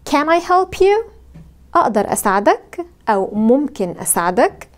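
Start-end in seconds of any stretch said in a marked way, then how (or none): 0.1-1.1 "Can I help you?" slow and high, voice rising at the end on you; rising question tune. Shown as a demonstration. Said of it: "قولوا معايا". (نغمة صاعدة في آخر السؤال)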